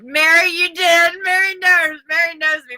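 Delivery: delighted shout-out to a viewer; excited praise. Real, not acted. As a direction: cheer Mary on for the right guess.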